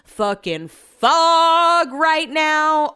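slow, high, loud groaning drawl; hungover groan. Real, not acted. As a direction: drag it out, head pounding. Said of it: "I just feel like I'm in a".

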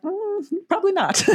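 high-pitched doubtful hum; skeptical reply. Retold or imitated. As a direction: squeak out a dubious, hedging answer. Voicing her doubtful reply to kids.

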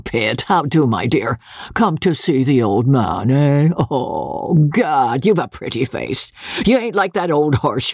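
put-on old country lord greeting guests with a cackle; coarse old baronet. Old baronet teasing his son's wife, leering. Voices old Sir Pitt.

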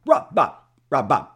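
high, loud clipped name repeat; Bob Harris voice. Retold or imitated. Editor phoning the artist, high loud name repeat. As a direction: fire the names off high and loud. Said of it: "That's how he talked".

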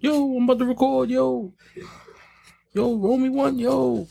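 high whiny begging voice; cowering plea. Retold or imitated. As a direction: beg for weed in a high voice. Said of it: "does that sound like begging?".

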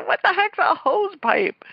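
high, slow incredulous asking; mock baffled question. Wife imitating herself puzzled by husband's hose pipe. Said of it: "I go".

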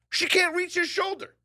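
very high-pitched disbelief; incredulous outburst. Real, not acted. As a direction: squeak it out in disbelief. Mock outrage at the claim.

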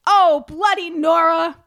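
loud, drawn-out mock cry of dismay; mock shout. Duke catching Nora with the kettle, mock shout. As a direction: belt it out like a horrified duke. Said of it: "he cried".

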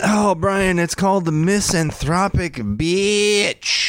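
showy announcement. Acted. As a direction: unveil the topic like a game host.

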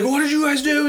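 high laughing mock fury; big mad. Peter furious at friends over kidnapped actor, mock outrage. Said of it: "he gets pissed, big mad".

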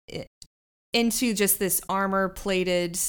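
ordinary talk

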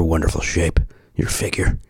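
breathy flirty whisper on figure. Pretend. Ad read aside, whispered to the listener. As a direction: lean in and whisper it.

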